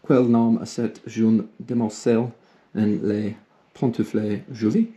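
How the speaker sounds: put-on fluent French with a native French accent; spoken French. Laurie speaking French for Jo, in a French accent. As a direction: Laurie shows off his French. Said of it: "Do say some".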